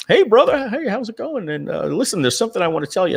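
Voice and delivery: a high, chummy friend on the phone; voicing Andy. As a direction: play the buddy calling with news.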